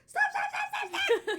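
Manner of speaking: very high, loud, rapid-fire repeats; frantic shouting. Retold or imitated. Sibling yelling at stuck child, frantic. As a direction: shriek it in hammed-up panic.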